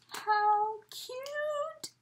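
high cooing how cute. Real, not acted. Admiring friend's handmade gift, cooing high. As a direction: coo at it like something tiny. Cooing over tiny item.

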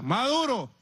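high-pitched mock street cry; imitated shout. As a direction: mimic people calling out to you in the street. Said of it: "la gente me grita".

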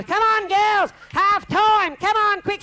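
high, loud bossy hostess voice; bossy matron calling. Retold or imitated. Maud hurrying the ladies to supper in a high, loud voice. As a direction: herd them out like a sergeant major.

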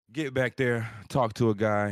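normal talking voice

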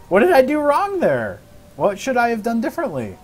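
high, loud frustrated question; exasperated. Real, not acted. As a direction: throw the question up in disbelief. Frustrated after near-failure.

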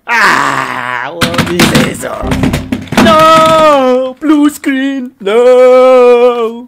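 high, loud wailing screams of despair. Real, not acted. Gamer watching a blue screen, screaming in despair. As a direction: scream as the computer dies.

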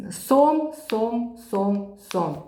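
repeating сом with a bright opening; speech therapist word repetition; word drill. Shown as a demonstration. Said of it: "І потім вже слова".

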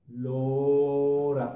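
"Lora" with a long held o; syllables stretched out. Shown as a demonstration. Teacher writing an example, "Lora" slowly stretched. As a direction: draw out "Lora" while writing it. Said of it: "Vamos a anotarla aquí".

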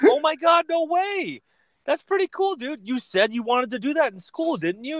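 very high, mock-thrilled voice; hammed-up delighted disbelief; gushing amazement. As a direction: gush over an old friend's big news. Acting out delighted reaction to friend's news.

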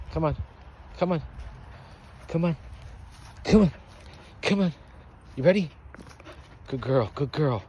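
loud eager coaxing; excited dog play. Real, not acted. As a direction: get the dog fired up to fetch. Hyping the dog to fetch.

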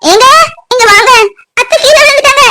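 high, tearful shout; crying out in alarm; panicked call for help. Acted. Calls husband for help, panicked.

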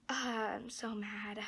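angry whisper under the breath. Real, not acted. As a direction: whisper it, fed up and annoyed. Frustrated, drops to whisper.

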